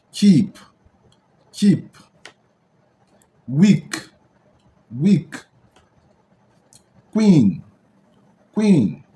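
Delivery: "Keep", "Weak", "Queen" high and loud with long "ee"; long ee sound. Shown as a demonstration. Teacher giving example words, Keep, Weak, Queen with emphatic "ee". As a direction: drill Keep, Weak, Queen, stressing the "ee" vowel. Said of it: "ça donne le son de".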